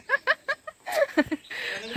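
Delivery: a burst of high-pitched laughter; sudden squeaky giggle. Real, not acted. Laugh after the call.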